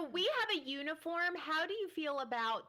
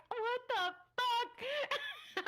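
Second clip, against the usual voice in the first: shocked; 11 semitones higher, much slower than usual. Retold or imitated. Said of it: "I was like".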